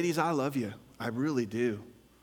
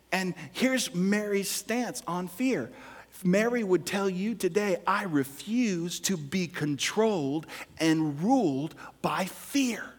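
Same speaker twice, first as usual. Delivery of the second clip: raised, drawn-out sermon delivery; emphatic preaching; 6 semitones higher, 5 dB louder than usual. Real, not acted. Pastor exhorting the ladies not to be ruled by fear, emphatic preaching. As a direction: preach it with slow, weighty emphasis.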